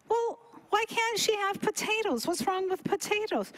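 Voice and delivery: high-pitched indignant questioning; acted protest. Challenges doctor banning potatoes.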